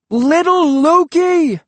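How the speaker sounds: loud, high, drawn-out roar. Acted. Boy roaring at his sister in the tree. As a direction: bellow her name up into the tree. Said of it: "I roared".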